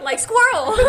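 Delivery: very loud, high mock exclamation; mock sudden distraction. Joking about being distracted during a first kiss, mock excitement. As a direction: blurt it out as a joke, hammed-up.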